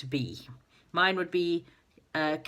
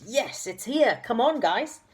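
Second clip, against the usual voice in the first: calling down the ladder, raised and higher; 6 semitones higher than usual. Acted. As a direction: shout it down to the others below. Saucepan Man calls down the ladder.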